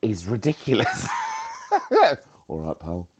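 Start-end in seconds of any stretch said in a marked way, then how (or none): 1.1-2.2 sudden high burst of laughter; a real shrieking giggle. Real, not acted. Caller laughing at herself, a high real laugh. 2.5-3.1 lower, softer gruff greeting; a hint of a butch working man. Caller showing how she butches up for the boiler man, lightly lower. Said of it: "I always butch up".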